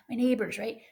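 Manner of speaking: normal talking voice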